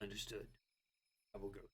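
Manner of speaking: low brooding fake-deep mutter; edgelord voice. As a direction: mutter it dark and moody. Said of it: "Gotta make it my edgelord voice".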